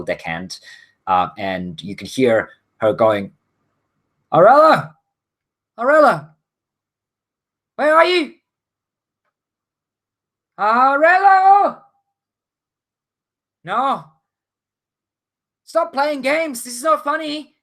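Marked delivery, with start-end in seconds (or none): 4.3-11.8 high, loud female deckhand calling; raised voice searching for a crewmate. Acted. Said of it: "She's calling out quite loudly". 13.6-17.5 high, upset female deckhand; high-pitched scolding call, not at full shout. Acted. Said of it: "She's calling out quite loudly".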